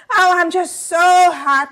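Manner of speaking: a bit of a hammed-up monkey; laying it on. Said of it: "that monkey just lays it on".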